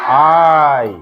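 "আই" drawn out as a long eye glide; vowel demonstration. Shown as a demonstration. Teacher modelling the joined vowel, "আই" stretched aa to ee. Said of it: "কি উচ্চারণ হবে?".

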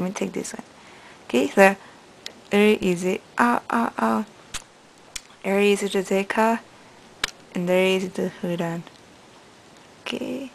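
lips pulled aside by fingers; talking while removing elastics; fingers in mouth. Real, not acted. Seen 5: Fingers inside mouth unhooking braces elastics while she speaks.

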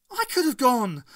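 high, loud upset insistence; upset cry. A narrator's reading. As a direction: blurt it out in hurt.

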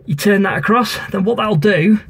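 ordinary talk, nothing marked

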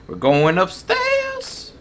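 slow teasing high singsong; drawn-out high sing-song. Pretend. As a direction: draw out upstairs like a spooky tune.

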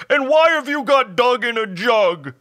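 high, loud blubbering creature voice; hammed-up slobbery wail; sobbing hobgoblin. A narrator's reading. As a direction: bawl it out like a wounded hobgoblin. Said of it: "the hobgoblin slobbered".

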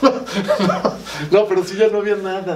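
nervous laugh. Real, not acted. Teller laughing nervously while describing the hospital, high giggle. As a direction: laugh it off in disbelief.

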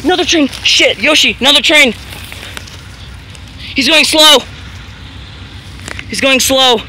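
high alarmed yell; panicked shouting. Real, not acted. Second train appears, shouting alarm.

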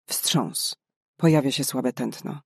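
usual speaking voice

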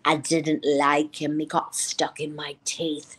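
low, slow, eerie imitation; weird Drusilla. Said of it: "you know, she's so weird".

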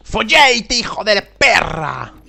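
loud, drawn-out curse; angry yell. Real, not acted. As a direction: curse slowly and loudly. Getting killed in game.

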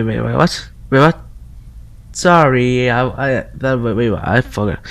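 ordinary delivery

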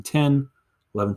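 ordinary delivery

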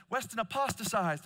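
high, loud imitation of alarmed children; mock panic. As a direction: raise the alarm in playful panic. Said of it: "And they start panicking".

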